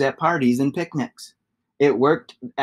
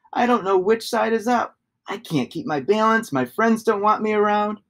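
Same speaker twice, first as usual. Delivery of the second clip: higher, a lightly voiced lost little shape; despairing shape; 7 semitones higher than usual. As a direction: plead like a dizzy lonely child.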